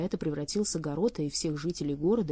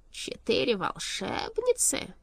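slow, higher, drawn-out question; incredulous echo; 5 semitones higher, slower than usual. A narrator's reading. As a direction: echo it slowly in disbelief. King echoes surprising news.

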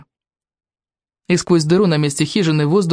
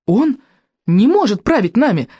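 shouting; 6 semitones higher than usual. A narrator's reading. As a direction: cry out against him. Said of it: "крикнула Камикадзе".

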